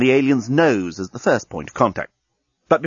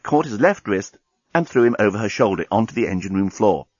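usual speaking voice

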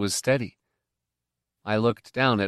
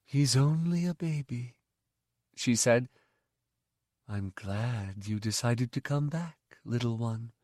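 tender mother; 5 dB quieter than usual. Acted. The mother cradles Miv, soft and tender. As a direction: soothe him like a sleepy baby. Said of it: "the mother said".

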